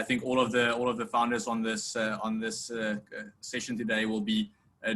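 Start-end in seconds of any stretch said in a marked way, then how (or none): none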